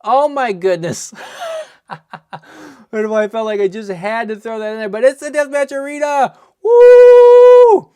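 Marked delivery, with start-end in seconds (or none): 0.0-1.1 higher and louder, pumping up the chat; rally the viewers; excited hype. Real, not acted. Streamer urges viewers to hit like, excited hype. Urging viewers after the pull. 1.2-1.8 high giddy burst of laughter. Real, not acted. Streamer laughs after the big pull, giddy laugh. 4.9-7.9 much higher, much louder, whooping; cheering. Real, not acted. Streamer cheers the pull to viewers, whooping cheer.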